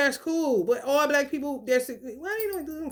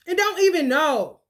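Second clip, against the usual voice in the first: normal talking voice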